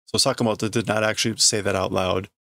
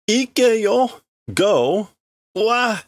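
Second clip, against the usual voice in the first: much higher and louder yell; shouted command; 11 semitones higher, 4 dB louder, much slower than usual. Acted. Sakamoto yelling at her to go. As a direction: bark the order out high.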